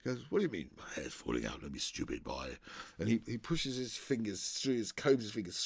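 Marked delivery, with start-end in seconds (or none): 0.3-2.6 lower, quick scornful put-down; mock gruff scoffing at the boy; scornful Oliver. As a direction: brush him off as a fool. Oliver scornful reply, voiced.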